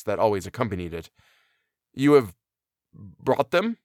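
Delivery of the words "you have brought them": halting question, broken by a pause; faltering. A narrator's reading. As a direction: falter before finishing the question. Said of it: "he faltered".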